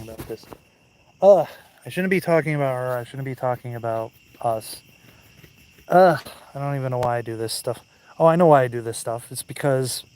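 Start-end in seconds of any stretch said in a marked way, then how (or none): 1.2-3.0 exasperated, louder and faster; frustrated. Real, not acted. Frustrated self-scolding. 5.9-9.3 loud, fast and exasperated; frustrated. Real, not acted. Frustrated self-talk.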